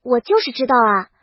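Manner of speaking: ordinary delivery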